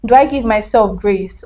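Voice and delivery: normal talking voice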